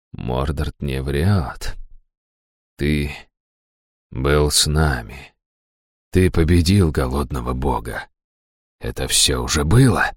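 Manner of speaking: hushed, slow, dazed voice; stunned. A narrator's reading. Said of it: "ошеломлённо сказал он". (ошеломлённо, тихо и медленно)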